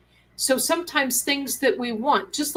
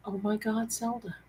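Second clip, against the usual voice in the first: softer, hushed friend's voice; a hint of the excited friend; 10 dB quieter than usual. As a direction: start into the caller's voice. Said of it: "I almost did the voice".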